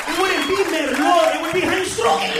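high, full-volume angry shouting; loud ranting, building to a yell; furious tirade. Acted. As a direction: blow up at the waiter. Acted tirade at French waiter.

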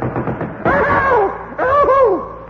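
very high sharp yelps of "Ow"; comic squealing cry of pain. Acted. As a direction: yelp high as if struck.

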